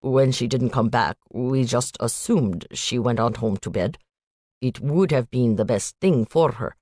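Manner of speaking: put-on light Indian accent, statements lifting at the ends; a hint of an Indian student, worried uptalk. Student telling the agent about Noelle, light Indian accent. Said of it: "a young man with an Indian accent".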